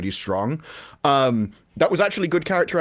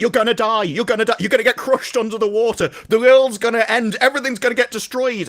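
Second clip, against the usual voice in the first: higher, louder, doom-laden mock wailing; mock hysterical ex-wife; 8 semitones higher, 5 dB louder than usual. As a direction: ham up a panicking ex-wife. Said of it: "she's like".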